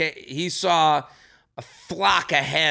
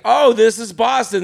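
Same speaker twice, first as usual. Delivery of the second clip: excited mock quote; 7 semitones higher, 8 dB louder than usual. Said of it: "I think it's like".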